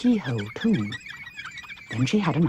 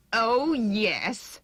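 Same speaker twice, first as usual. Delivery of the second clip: sneering; 8 semitones higher than usual. A narrator's reading. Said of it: "sneered the head Wheeler".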